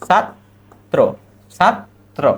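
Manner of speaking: ছাত্র split as "sat", pause, "tro"; sounded-out pronunciation. Shown as a demonstration. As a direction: dictate ছাত্র in two separate syllables. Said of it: "তার উচ্চারণ আমরা কিভাবে লিখি?".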